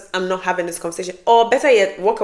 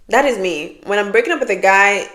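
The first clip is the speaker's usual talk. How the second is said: normal talking voice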